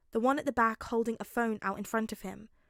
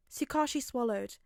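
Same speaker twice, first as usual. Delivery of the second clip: ordinary talk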